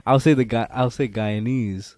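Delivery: usual speaking voice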